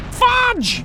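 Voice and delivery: very high, sudden yelp; high-pitched outburst; shouted mock curse. Real, not acted. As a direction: yelp it out in frustration. Exclaims at mishap in game.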